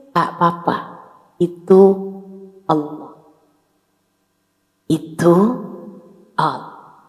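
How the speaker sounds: lightly voiced mother soothing her child. Mother comforting a hurting child. (ibu menenangkan anak dengan lembut dan pelan)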